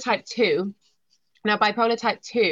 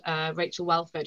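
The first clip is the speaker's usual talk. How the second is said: normal talking voice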